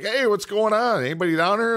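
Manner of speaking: retold calling out. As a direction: call out into the basement, retelling it. Retold calling into a dark basement.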